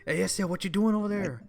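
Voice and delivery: playful exaggerated Mexican homeboy accent; put-on Mexican accent. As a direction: call out in a mock Mexican accent. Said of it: "This is how I should be talking".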